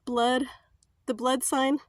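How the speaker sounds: ordinary delivery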